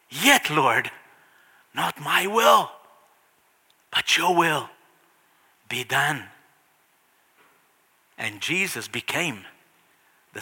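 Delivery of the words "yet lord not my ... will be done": yielding surrender. Acted. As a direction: let go and accept gently. Jesus surrendering.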